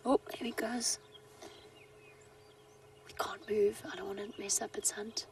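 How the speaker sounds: hushed whisper so the cat stays calm; wildlife watching whisper. Real, not acted. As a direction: whisper softly, don't scare the animal. Cat hunting, guide not disturbing it.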